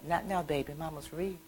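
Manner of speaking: very soft, absorbed murmur; hushed, unbothered brush-off; mother's same tone. Retold or imitated. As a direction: imitate Mama quietly brushing the child off. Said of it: "say the same thing".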